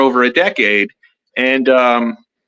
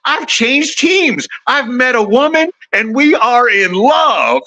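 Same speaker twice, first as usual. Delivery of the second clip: friend's announcement; 9 semitones higher, slower than usual. Retold or imitated. Friend's big news retold.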